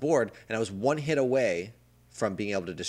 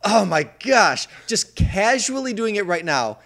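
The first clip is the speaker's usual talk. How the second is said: amazed; 7 semitones higher, 9 dB louder than usual. Real, not acted. Amazed at friend's fast score.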